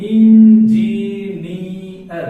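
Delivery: loud, very slow "engineer", each syllable held; drawn-out syllables. Shown as a demonstration. Teacher asking learners to pay close attention, loud drawn-out syllables. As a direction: stretch "engineer" loudly as in-ji-ni-ar. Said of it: "बड़ा गौर करेंगे".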